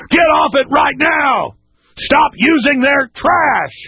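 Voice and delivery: high, slow shouted commands; slow furious yell; angry shout. Real, not acted. Ordering young people off drugs.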